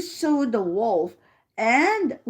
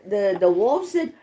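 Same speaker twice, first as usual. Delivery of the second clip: usual speaking voice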